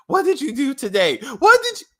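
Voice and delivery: high, loud mocking whine of the wife; mocking whiny wife. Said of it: "This ungrateful bitch was like".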